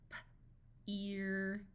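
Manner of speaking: puffed P, pause, drawn-out "ear"; sounded out. Shown as a demonstration. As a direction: break "P-ear" into its sounds slowly. Phonics teacher sounds out, then blends.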